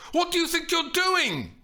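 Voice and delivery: very high, loud indignant shout; high-pitched furious demand; outraged yell. A narrator's reading. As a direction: yell at him to back off. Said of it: "she yelled".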